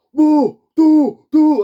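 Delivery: very high, loud mock hooting; erupting crowd. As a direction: imitate an outraged cinemagoer whooping. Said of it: "this group erupted".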